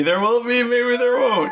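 high coy sing-song tease; teasing. Acted. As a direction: coyly dangle the mystery.

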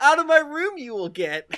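high, loud teen Yoda impression; whiny teenage Yoda.

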